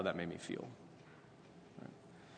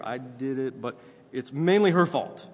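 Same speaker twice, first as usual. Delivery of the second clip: mock Adam shoving the fault onto her; blame-shifting husband; 3 semitones higher, 7 dB louder than usual. Husband insisting it's his wife's fault, loud. Husband voiced blaming wife.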